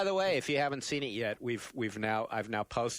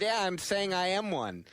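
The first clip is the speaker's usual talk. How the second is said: mock defensive; 8 semitones higher, 4 dB louder than usual. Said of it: "And I said".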